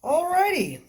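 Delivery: high chirpy alrighty; perky. Real, not acted. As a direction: chirp it to reset the mood. Moving on cheerfully.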